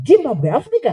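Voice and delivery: put-on high, amazed Japanese-accented echo; mock Japanese child. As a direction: imitate a Japanese kid's disbelief.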